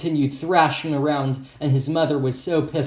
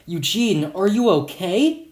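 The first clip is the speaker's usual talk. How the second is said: raised voice climbing to a high question; mild exclamation; 5 semitones higher than usual. Acted. Friend kneeling beside hurt boy, mildly exclaiming. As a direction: exclaim it lightly, lifting at the end. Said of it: "one of them exclaimed".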